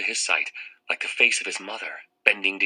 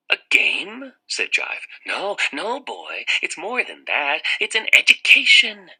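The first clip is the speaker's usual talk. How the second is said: sly mentor creature; 10 semitones higher, 5 dB louder than usual. Acted. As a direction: correct him with relish. Jive correcting Harvey.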